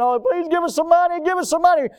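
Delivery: high mock pleading; begging. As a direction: whine for money like a beggar. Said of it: "they're out there begging".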